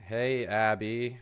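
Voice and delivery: drawn-out, exaggerated hey Abby; slow, showy "Hey, Abby." for a donor; slow hammed-up greeting. Shown as a demonstration. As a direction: stretch "Hey, Abby." out slowly, playing it up for the camera. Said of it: "so I guess I'll do it".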